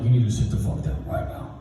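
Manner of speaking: lightly voiced principal, low and hushed; stern principal. Principal ordering the boy in his office, sternly. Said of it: "and the principal's like".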